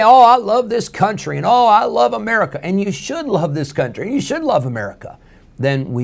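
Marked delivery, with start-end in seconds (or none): 0.0-1.4 mock-gushing, much higher and louder; gushing patriot. Preacher mimicking a proud patriot, gushing high and loud. 1.5-2.6 mock-gushing, much higher and louder; gushing patriot. Preacher mimicking a proud patriot, gushing high and loud.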